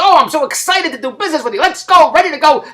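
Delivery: high-pitched eager gushing; mock excitement. Business partners promising to work together, mock-excited. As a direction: imitate a keen partner raring to start.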